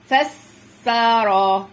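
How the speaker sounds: فسر with a long hissed س, then loud held vowels; teacher stretching every syllable of فسر; drawn-out syllables. Shown as a demonstration. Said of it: "والتانية مفتوحة لوحدها".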